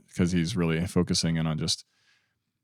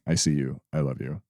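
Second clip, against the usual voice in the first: tender reassurance; 5 semitones lower, 4 dB quieter than usual. Retold or imitated. Husband reassuring his frightened wife, softly and lower. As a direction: lower and softer, gently reassuring.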